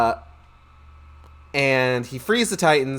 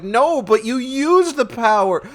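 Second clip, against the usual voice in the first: much higher, pushy goddess with a gasp; high mocking Athena; shrill goddess voice; 7 semitones higher, 3 dB louder than usual. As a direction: play Athena as a high insistent nag.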